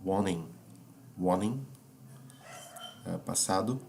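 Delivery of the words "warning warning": "warning" with no T sound, said wo-ning; flapped T. Shown as a demonstration. Teacher to students, showing "warning" without the T sound. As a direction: drop the T in "warning" entirely. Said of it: "Vai ficar assim, veja".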